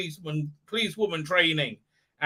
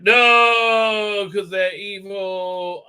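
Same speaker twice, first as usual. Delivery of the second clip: mock shout; 4 semitones higher, 7 dB louder, much slower than usual.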